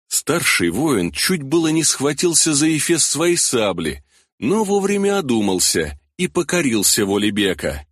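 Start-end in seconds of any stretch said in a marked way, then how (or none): none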